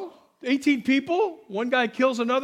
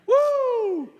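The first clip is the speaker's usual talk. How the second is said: excited whoop; 12 semitones higher than usual. Real, not acted. Preacher reading God's defeat of Satan, real excited whoop. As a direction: burst out with a real joyful woo. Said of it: "I got excited".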